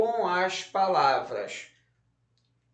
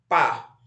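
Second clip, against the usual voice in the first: Pá alone as a loud, low, falling syllable; strong syllable; 4 semitones lower, 5 dB louder than usual. Shown as a demonstration. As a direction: punch out Pá by itself as the stressed syllable. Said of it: "Qual é a sílaba mais forte?".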